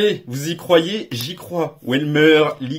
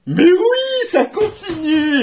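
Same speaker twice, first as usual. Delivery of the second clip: much higher squeal easing into a laugh; thrilled high cry, then a chuckle; ecstatic squeal; 12 semitones higher, 3 dB louder, slower than usual. Real, not acted. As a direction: squeal with joy at the streak. Ninth hit in nine boosters.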